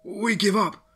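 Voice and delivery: high, loud panicked scream; guard screaming his surrender to the dogs. A narrator's reading. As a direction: yell it out in terror, hands up. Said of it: "two guards screamed".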